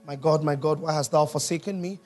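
ordinary delivery

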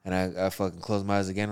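usual speaking voice

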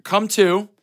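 ordinary delivery

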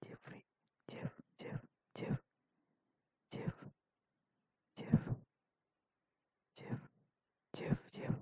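urgent hushed whisper calling a name. Real, not acted. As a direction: whisper his name into the dead line. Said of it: "ngomong pelan gitu".

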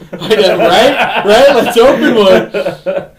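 giggly agreement, cracking up; cracking up at the friend's idea to open a shop; laughing. Real, not acted.

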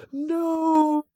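high, loud drawn-out no; anguished scream. Acted. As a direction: scream it in despair. Hippie uttered in horror.

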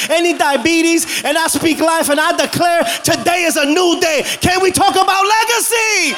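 high, driving declaration of blessing; preaching declaration. Real, not acted. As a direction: proclaim it over the congregation, building to a cry. Declares blessing over congregation.

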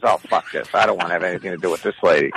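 low, hurried mock resignation; surrendering guy. Hostage-taker faced with the mother, low hurried surrender. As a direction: imitate a man giving up fast. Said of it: "comes out with his hands up".

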